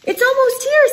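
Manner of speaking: high bird voice with rising tune; chickadee voice. Acted. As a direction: chirp it upward like a chickadee. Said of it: "says a chickadee".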